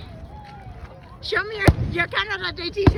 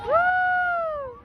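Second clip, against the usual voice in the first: long, high whoop; cheering woo; 12 semitones higher than usual. Real, not acted. Cheering the Canada Day fireworks, high whoop. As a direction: give a high, drawn-out woo.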